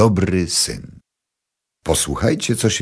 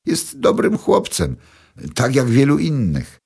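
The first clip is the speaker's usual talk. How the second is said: modest mother; 10 semitones higher, 3 dB louder than usual. A narrator's reading. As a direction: say it simply, not boasting. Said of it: "Sama nie wiem". (trzecia kobieta skromnie mówi o synu, wyżej)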